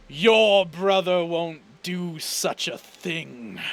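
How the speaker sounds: big, slow, booming villain voice; booming, echoing voice of a cruel father. Acted. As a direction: loom over him, savouring every word. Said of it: "with a big, booming, echoing voice".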